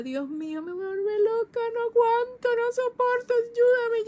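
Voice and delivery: high, loud desperate cry for help. Retold or imitated. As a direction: cry out near breaking point. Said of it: "yo decía". (grito desesperado, agudo y fuerte)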